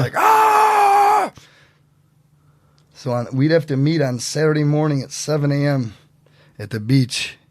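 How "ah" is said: high, loud drawn-out "ah" scream; long high-pitched yell of going nuts; mock scream.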